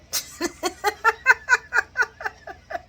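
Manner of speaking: high, pulsing cackle; long cackling laugh. Real, not acted. Recapper tickled by the kissing scene, long cackle. Laughs after her own cheer.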